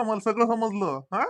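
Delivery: ordinary talk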